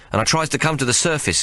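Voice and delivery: ordinary delivery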